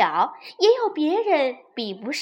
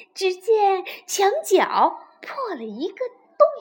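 plain reading voice

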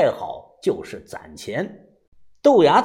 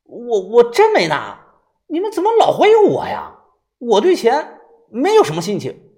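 high, loud, wronged denial; indignant outburst, stammering at the start; loud protest; 7 semitones higher, 5 dB louder than usual. A narrator's reading. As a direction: protest your innocence loudly, pitch up. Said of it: "大声地说道".

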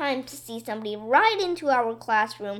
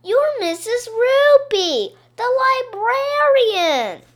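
high, loud, slow insistence; hollering; 10 semitones higher, 7 dB louder, slower than usual. A narrator's reading. Kids telling Missus Roopy who she is, hollering. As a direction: shout it out slowly as an accusation. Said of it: "we all hollered".

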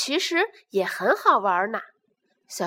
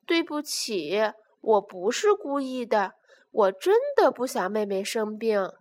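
plain reading voice

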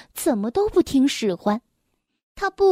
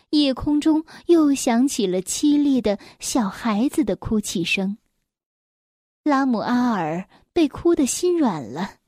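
plain reading voice

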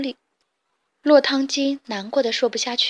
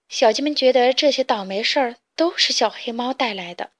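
usual speaking voice

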